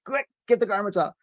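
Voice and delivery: hammy hurry-up alarm; overacted death scene. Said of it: "with a really dramatic death scene".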